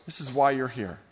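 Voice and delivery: normal talking voice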